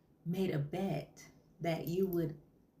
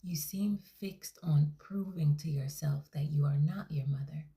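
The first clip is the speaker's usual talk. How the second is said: whispered counselling voice; therapist whisper; 2 semitones lower than usual. Acted. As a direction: hush every word like a calming counsellor. Therapist whispers throughout session.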